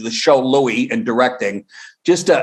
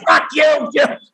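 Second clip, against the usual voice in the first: high mock child shout; child auditioning; 12 semitones higher, slower than usual. Comedian imitating little girls at the audition, high shout. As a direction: bark it like a six-year-old auditioning. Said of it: "age six going".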